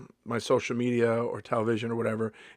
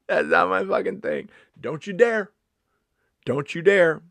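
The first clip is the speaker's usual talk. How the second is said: raised, higher-pitched warning; mock scolding; 6 semitones higher, 7 dB louder than usual. Comedian warning audience not to talk back, mock scolding. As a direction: scold them, loud and indignant.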